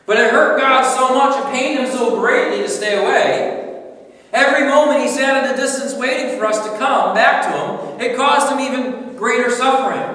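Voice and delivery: loud, high, soaring sermon voice; full-force preaching. Acted. Preacher pressing God's pain on the congregation, loud. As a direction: preach it out to the whole room.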